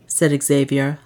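ordinary delivery, nothing marked

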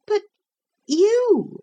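put-on high-pitched, puzzled boy; bewildered. A narrator's reading. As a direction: falter in high-voiced bewilderment. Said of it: "more and more bewildered".

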